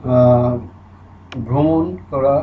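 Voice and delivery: normal talking voice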